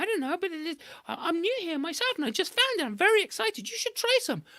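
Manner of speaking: very high sweet wheedling; coaxing trickster. A narrator's reading. Anansi coaxing Monkey toward the honey tree, high and wheedling. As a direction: sell the honey hard.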